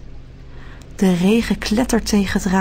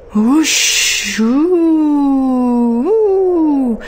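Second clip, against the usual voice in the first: rising, louder howling wind; storm wind; 5 semitones higher, 4 dB louder, much slower than usual. A narrator's reading. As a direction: howl up like a storm. Storm noises.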